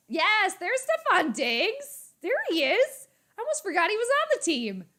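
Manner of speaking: high, loud delighted squeal; excited fan cheer. Real, not acted. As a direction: cheer a favorite player's appearance in a high voice. Spots a favorite player.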